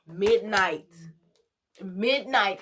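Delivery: loud, drawn-out midnight; dramatic emphasis. Real, not acted. Storyteller building suspense for viewers, loud and drawn out. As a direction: land midnight hard like a reveal.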